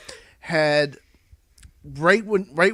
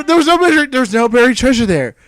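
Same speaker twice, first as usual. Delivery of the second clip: usual speaking voice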